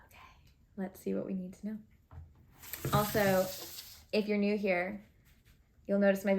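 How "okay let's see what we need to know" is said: whispered to herself. Real, not acted. Talking to herself before the reading.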